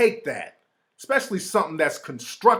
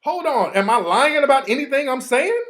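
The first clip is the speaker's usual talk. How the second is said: higher-pitched indignant comeback; mildly riled retort, pitched high; pissed-off challenge; 8 semitones higher, 5 dB louder than usual. Retold or imitated. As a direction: re-enact getting riled, voice up. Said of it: "I'm getting a little pissed off now".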